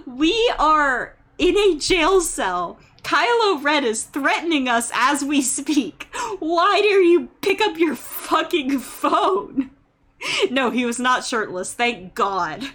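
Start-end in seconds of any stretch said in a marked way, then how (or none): 0.1-9.7 drawn-out, higher, indignant scolding; exasperated outrage. Acted. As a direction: play up your disbelief at someone's rudeness. Acted outraged rant at phone user.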